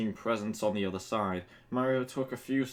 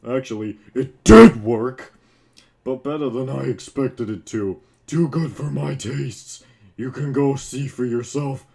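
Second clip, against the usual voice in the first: louder, lightly voiced beaten villain conceding; a hint of a grudging loser handing over the win; villain giving up to the hero, lightly voiced and louder; defeated villain; 10 dB louder than usual. Bowser's lines in the story.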